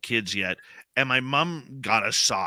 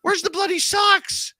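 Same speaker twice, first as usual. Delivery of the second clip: high, loud mock outrage; indignant call; 5 dB louder, slower than usual. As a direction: call out to mom in playful protest. Kids calling out mom in story.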